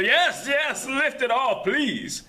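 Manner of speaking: high, loud begging; a prisoner pleading to be freed, high and loud; desperate plea. Acted. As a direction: beg him to lift the bolt.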